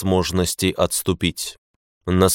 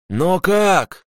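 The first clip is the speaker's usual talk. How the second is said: high-pitched, stunned question; taken aback; 11 semitones higher than usual. A narrator's reading. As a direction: blurt it out in astonishment. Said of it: "опешил Суджук". (опешив, высоким голосом)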